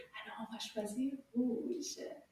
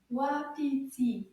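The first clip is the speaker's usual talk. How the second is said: "Wapiti" said slowly, syllables apart; slow model; slower than usual. Shown as a demonstration. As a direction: stretch "Wapiti" out so it can be repeated. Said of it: "a little bit faster".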